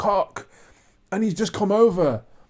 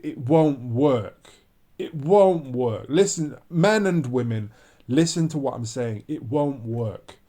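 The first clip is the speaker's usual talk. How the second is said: lower, slow, each word weighted; slow low warning; 6 semitones lower, slower than usual. Real, not acted. Vlogger warns men and women it won't work, slow and low. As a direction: drive it home slowly.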